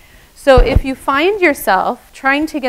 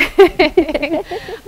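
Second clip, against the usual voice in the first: light laugh; 7 semitones higher, 5 dB louder than usual. Real, not acted. Laughs about silly sounds.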